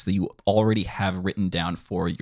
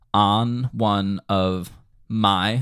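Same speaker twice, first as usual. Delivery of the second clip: very slow, separated words; much slower than usual. Shown as a demonstration. Teacher marks up the lyric, slowly word by word. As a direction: leave space after each word. Spaces words out while marking them.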